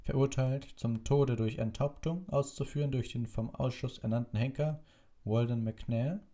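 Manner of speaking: high-pitched official reading a decree; official proclamation. Acted. Committee official reading out the execution order, high and formal. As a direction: read it out formally, pitched well up.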